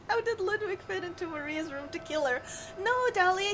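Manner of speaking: ordinary talk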